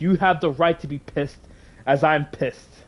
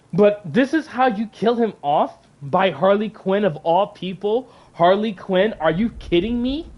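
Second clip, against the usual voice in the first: rising, outraged questions; indignant disbelief, pitch climbing at the end; incredulous; 3 semitones higher than usual. Real, not acted. Outraged at how Batman dies.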